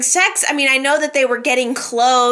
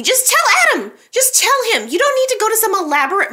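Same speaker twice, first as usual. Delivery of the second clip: urging; 10 semitones higher, faster than usual. Real, not acted. Pleading with character on screen.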